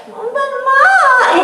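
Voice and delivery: put-on high, loud nasal whine of a child; whiny kid. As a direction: whine it out like a dying animal. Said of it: "worst combination of sounds".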